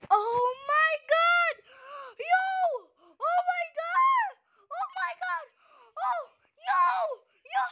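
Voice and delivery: high, loud screams of disbelief; excited screaming. Real, not acted. Gamer screaming to viewers after hatching a lucky pet, excited shrieking. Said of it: "sorry guys if I'm screaming too much".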